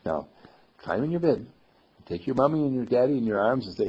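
plain reading voice

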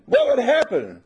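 loud high call of the question; shouted question. Shown as a demonstration. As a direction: throw the question out to wake them. Repeats his earlier shouted question.

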